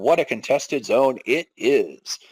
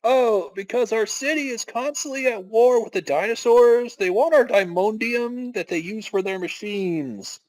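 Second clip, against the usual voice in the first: bright, higher character voice; NPC townsperson; 9 semitones higher than usual. Acted. Guard answering the party in a bright, higher voice. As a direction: play the cheery city guard.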